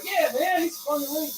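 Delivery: high-pitched excited retelling; mock excited. Joking about the leak to a friend, mock excited. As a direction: imitate him in a high, excited voice.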